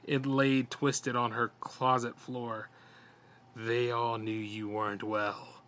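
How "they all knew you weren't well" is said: lightly voiced slick monster, slow and softer; a hint of a slithering creature, slowly. A narrator's reading. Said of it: "Its voice was slick".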